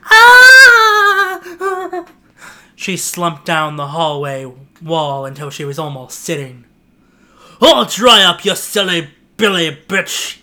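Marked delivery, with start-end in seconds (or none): 0.1-2.1 high shrill cry of fear; a sudden startled scream. Acted. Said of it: "She cried out more in fear". 7.6-10.4 loud sneering yell. Acted. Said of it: "yelled the masked boy".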